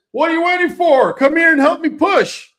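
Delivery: imitating the trucker. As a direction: squeak out the trucker's bossy order. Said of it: "he looks at me and he says".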